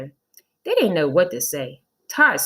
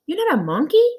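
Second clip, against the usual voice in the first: surprised high; 10 semitones higher, 3 dB louder than usual. A narrator's reading. Reading Mrs Jules aloud.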